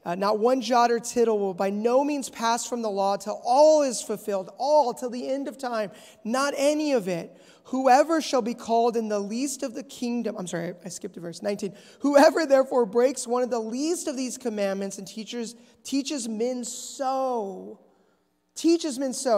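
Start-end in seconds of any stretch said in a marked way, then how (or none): 0.2-10.4 loud, forceful verse reading; preaching scripture reading. Acted. Preacher reading the law's fulfillment to the church, forceful proclamation. 12.0-19.4 loud, emphatic Bible verse; preaching scripture reading. Acted. Preacher reading verse nineteen to the church, emphatic proclamation.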